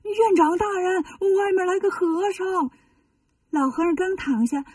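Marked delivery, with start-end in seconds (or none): 0.0-2.7 high, frightened call, slowing down; terrified errand monk calling his master, much higher; scared. Acted. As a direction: yelp it out in a panic. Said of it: "吓得扭头就跑".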